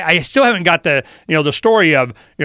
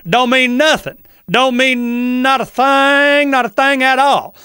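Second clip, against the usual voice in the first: higher, drawn-out Southern accent, lightly put on; slow Southern drawl, stretched vowels; 7 semitones higher, slower than usual.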